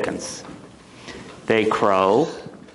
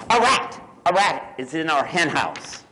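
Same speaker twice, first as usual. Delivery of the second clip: alarmed hen, loud high burst then easing; storybook panic, starting high and loud; squawking hen; 5 semitones higher, 3 dB louder than usual. Acted. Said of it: "squawked the hen".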